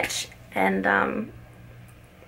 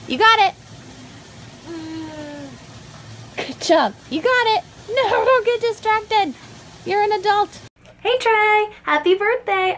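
cheering; 11 semitones higher than usual. Real, not acted. Mother cheering her baby's first steps, high. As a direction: celebrate every step. Cheering baby, birthday greeting.

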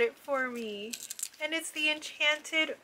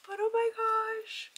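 awed gasp; 10 semitones higher than usual. Real, not acted. Admiring the ornament again.

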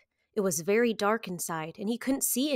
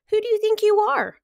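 high, loud offended cry; indignant cry; 12 semitones higher, 7 dB louder than usual. A narrator's reading. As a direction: snap back in proud outrage. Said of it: "cried the Rainbow Fish".

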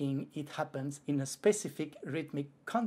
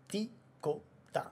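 slow spoken rhythm; 5 semitones higher, much slower than usual. Shown as a demonstration. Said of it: "tikota is one red Lego".

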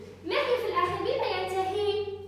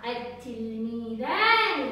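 slow modelled word; 7 semitones lower, slower than usual. Shown as a demonstration. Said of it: "وفي المثنى أطفالي قلنا".

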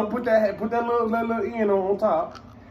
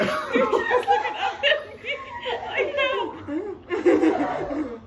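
pained laughter. Real, not acted. Pained laugh during chip challenge.

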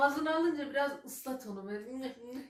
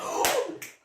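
shocked gasp; 10 semitones higher, 5 dB louder than usual. Real, not acted. Shocked at the word amele.

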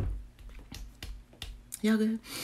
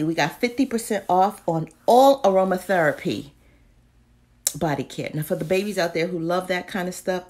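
ordinary talk

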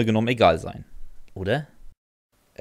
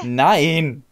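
a higher, louder flat no; refusing the pony again, firm and louder; firm refusal; 6 semitones higher, 6 dB louder than usual. Acted. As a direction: shut her down with a firm no.

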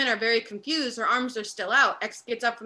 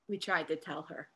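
lower, much softer, half under the breath; quiet aside; 6 semitones lower, 9 dB quieter than usual. Acted. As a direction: mutter it, repeating the point to yourself.

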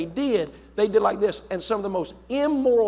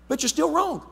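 higher, playful dad voice; parent voice; 9 semitones higher than usual. Retold or imitated. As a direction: tease as the dad who is always right. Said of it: "I need to say".

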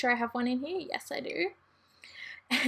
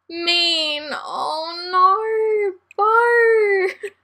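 mock crying; 7 semitones higher, 7 dB louder, much slower than usual. Dismay at bad trait.

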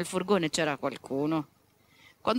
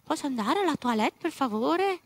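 polite rabbit asking; 5 semitones higher than usual. Retold or imitated.

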